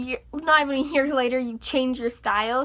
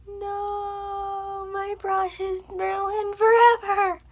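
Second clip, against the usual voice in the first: high, slow wail of mock despair; hammed-up lament over a ruined brush; 7 semitones higher, much slower than usual.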